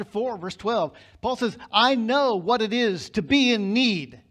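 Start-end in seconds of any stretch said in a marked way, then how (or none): 1.7-4.2 loud, driving sermon voice, pressing every point; fiery preaching. Acted. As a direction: preach it out full force to the congregation. Preaching at full volume.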